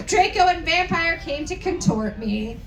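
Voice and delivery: ordinary talk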